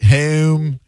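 "Home" with a rounded Delco O, lightly imitated; Delco accent. Host echoing caller's Delco accent, lightly imitated. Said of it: "It's almost like a surfer accent a little bit".

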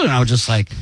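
normal talking voice

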